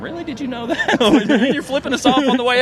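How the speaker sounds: sarcastic "really?" with a laugh; mock disbelief. Fan mocking the coach's claim, high and amused. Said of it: "And I was sitting there thinking".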